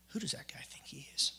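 hushed, whispery grumble; resentful mutter. Retold or imitated. Worker walking away from his boss, muttering resentfully. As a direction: mutter it under your breath.

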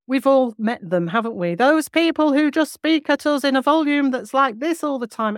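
overbearing loudness played for effect; too loud. Shown as a demonstration. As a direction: talk at them at full blast. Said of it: "I sort of went overboard there".

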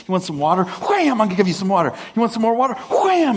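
high, hammed-up taunts at the rock; mock tough guy. Moses practicing on rocks, high mock taunting. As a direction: gleefully taunt the rock before each whack. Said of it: "I'm gonna practice with this thing".

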